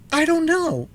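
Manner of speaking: high, loud, flustered reply; nervous impatience. A narrator's reading. Boy answering the Gump, nervous at the delay. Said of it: "who was very nervous at the delay".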